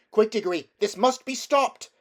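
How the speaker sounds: high, loud and urgent; urgent call. A narrator's reading. Polly calling Diggory to stop the fight, urgent call. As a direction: urge him to act now.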